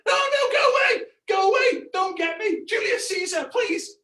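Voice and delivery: high, shrieked cowardly pleading; mock terror. Cowardly fighter fleeing round the Colosseum, shrieking in mock terror. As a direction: scream for mercy, hammed up. Mocking a cowardly gladiator's plea.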